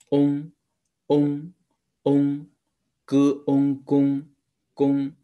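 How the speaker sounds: 嗡 and 公 sounded high and loud, drawn out; sounding out words. Shown as a demonstration. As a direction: build 公 from 歌 plus 嗡. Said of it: "这个是".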